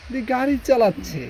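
sudden high, loud call-out; excited. Real, not acted. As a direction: jump up in excitement. Live reaction to a player driving.

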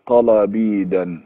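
طلبيدا said very slowly, ending in ‑يدا; Persian verb form. Shown as a demonstration. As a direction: drag out طلبيدا syllable by syllable. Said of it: "عشان يبقى آخرها دال ونون". (نطق بطيء جدًا لطلبيدا)

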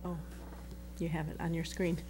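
normal talking voice

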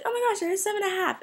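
high, fast, squealing delight; thrilled gasp. Retold or imitated. Shopper finds shoes in her size, excited squeal. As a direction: blurt it out, amazed they're your size. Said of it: "I was like".

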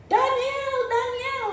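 king crying out to Daniel, pitched high; anguished calling. Retold or imitated. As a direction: call his name into the den. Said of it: "in an anguished voice".

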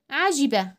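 quick kasra in عجبة; short i in عجبة. Shown as a demonstration. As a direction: keep the i of عجبة brief. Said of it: "الكسرة حركة قصيرة".

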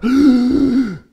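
sharp loud gasp of shock; startled gasp. Retold or imitated. Woman suddenly overwhelmed by his confession, startled gasp. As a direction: she gasps as it suddenly dawns on her. Woman realises she is loved.

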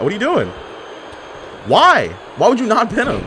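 high exasperated question; incredulous. Real, not acted. Gamer scolding a partner who won't pin, high exasperated. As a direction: pitch up in disbelief.

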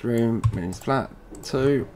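ordinary talk, nothing marked

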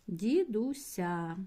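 "Дідуся" stretched very slowly; teacher dictating slowly while writing; slow dictation. Shown as a demonstration. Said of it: "Записую".

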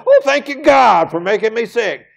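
high, loud sarcastic thanks; hammed-up thankful voice; mock gratitude. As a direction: send up the grateful believer. Mocking a grateful ex-drinker.

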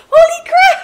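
high, loud cry breaking into laughter; elated laughing shout. Real, not acted. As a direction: shout it through real, helpless laughter. Overjoyed at milestone.

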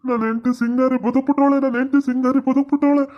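high-pitched elated outcry; overjoyed. Acted. Husband rejoicing that his wife is alive, overjoyed. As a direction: cry out the good news in joyous disbelief.

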